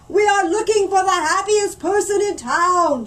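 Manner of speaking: mayor shouting to a distracted mother; shouted. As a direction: shout so she hears. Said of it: "shouted the mayor".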